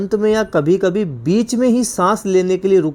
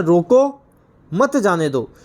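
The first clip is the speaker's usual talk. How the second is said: रोको set apart, half-second break, मत जाने दो; policeman's order, split after रोको; pause after रोको; slower than usual. Acted. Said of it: "क्या बोल रहा है?".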